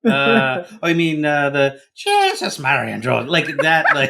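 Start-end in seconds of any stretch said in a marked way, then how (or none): 2.0-3.3 Irish beat cop. Shown as a demonstration. Dad demonstrating kids' book character, Irish cop voice. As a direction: exclaim it as a stock Irish policeman. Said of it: "Irish Beat Cop from a nineteen thirties movie".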